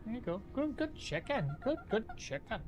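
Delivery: high, soft praising pet voice. Real, not acted. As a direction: praise the bird like a puppy. Praising his chicken.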